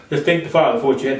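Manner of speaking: normal talking voice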